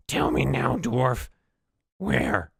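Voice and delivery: high, loud goblin snarl; menacing goblin. Acted. Goblin torturer pressing the dwarf, loud and high. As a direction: demand the answer from the prisoner.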